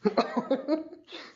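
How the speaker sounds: a quick high giggle; a short embarrassed giggle. Real, not acted. Laughs about needing gum.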